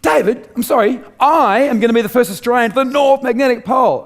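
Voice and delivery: high, loud declaration of the goal; defiant shout. Retold or imitated. Telling mentor David he'll reach the North Magnetic Pole, shouting. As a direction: shout it out, fully determined.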